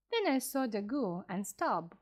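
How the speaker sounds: ordinary delivery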